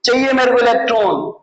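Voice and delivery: ordinary talk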